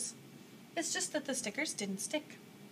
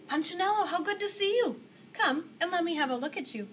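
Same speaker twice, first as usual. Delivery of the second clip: louder, higher, delighted to see him; joyful booming welcome; 7 semitones higher, 6 dB louder than usual. Acted. The maker greets little Punchinello with a loud, joyful cry. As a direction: call out like a father overjoyed. Eli warmly greets his creation.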